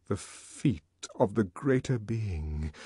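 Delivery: slow, soft, suspenseful echo; low-volume awed murmur; hushed. A narrator's reading. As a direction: say it softly, word by word. Awed echo of whispered words.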